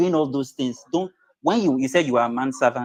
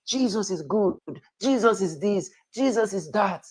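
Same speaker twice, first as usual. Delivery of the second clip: lightly imitating a preacher, pitched higher and a bit softer; mock preaching; 6 semitones higher, 5 dB quieter than usual. Man mocking a preacher who comes to convert him, mock preaching. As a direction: parrot a street preacher's sing-song pitch. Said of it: "if someone come to me and preach".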